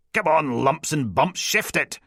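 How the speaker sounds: high-pitched, bossy hurry-up; impatient chivvying. A narrator's reading. Sergeant whipping officer up the stairs, impatient chivvying. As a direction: drive them on with a high, urgent call.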